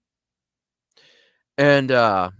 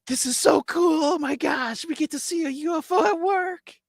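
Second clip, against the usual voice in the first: put-on high falsetto, thrilled like a kid; giddy excitement. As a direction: gush over it in a squeaky voice. Giddy soldier watching UFO.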